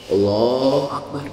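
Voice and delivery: low, slow, drawn-out Allahu Akbar in a mocking voice; mock effeminate takbir. Shown as a demonstration. Preacher mocks men's effeminate takbir, slow and low. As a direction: drag out Allahu Akbar to mock men who pray like women. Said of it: "sekarang ada yang laki-laki begitu".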